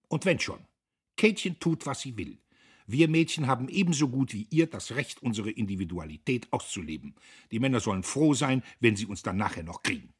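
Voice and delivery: high, loud defiant declaration; feisty rebuttal. Acted. Emmy defiantly argues with her brother.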